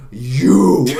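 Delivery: loud mock yell of You; accusing shout. Friend voicing the landlady yelling at Singh, loud mock accusation. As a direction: bark it like a furious landlady. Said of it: "he's like".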